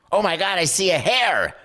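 high-pitched thrilled outburst, re-enacted; excited discovery. Seventh grader spotting his first hair, mock excitement. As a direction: burst out high in mock amazement.

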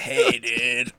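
put-on shady teen dealer voice; sulky teen mumble. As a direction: play a sketchy teenage vape seller.

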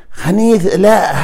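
خنيث لا high and stretched; stressed no. Shown as a demonstration. (خنيث لا بتشديد عالي وممطوط)

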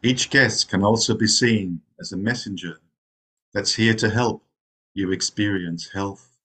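unhurried meditation guide, long pauses between phrases; guided meditation. Real, not acted. Zen teacher settling meditators into rest, guided meditation voice. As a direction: ease them into rest, phrase by phrase.

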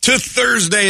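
big drawn-out DJ hello; hammed-up show opener. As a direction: sing out the morning welcome. Radio host opens morning show.

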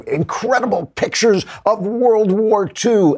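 high, slow, hammed-up headline voice; mock clickbait hype. Mocks a clickbait caption's hype.

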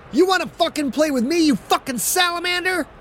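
high, furious challenge to a rival; angry taunt. Acted. Quarterback taunting the lineman who cut him, furious. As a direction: spit it at him, spoiling for a fight. Quentin yelling at opposing lineman.

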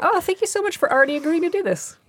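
high-pitched grateful gush, demonstrated; presumed agreement. Shown as a demonstration. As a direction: thank them as if it's already settled. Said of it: "as if they have already agreed".

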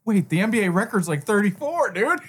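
high mock gambler voice; Adam Sandler voice. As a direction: keep the frantic gambler bit going. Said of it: "you're doing Adam Sandler Uncut Gems".

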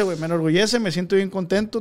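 usual speaking voice

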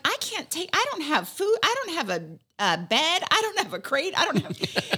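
high, rushed imitation of a flustered friend; panicked friend. Friend imitated refusing the puppy, flustered. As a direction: play her overwhelmed. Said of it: "she's like".

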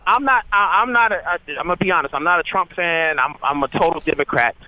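normal talking voice

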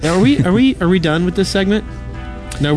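ordinary talk, nothing marked

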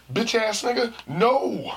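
high, loud mock outrage; hammed-up indignant outburst; acted indignant refusal. Acted refusal to ride again.

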